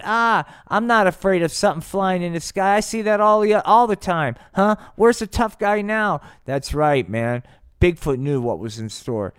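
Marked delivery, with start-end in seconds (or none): 0.0-4.3 high, loud mock bravado; mocking tough-guy imitation. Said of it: "the tough guy that was saying". 4.5-6.2 high, loud jeering challenge; gloating taunt. Acted. Bigfoot taunts Rudolph.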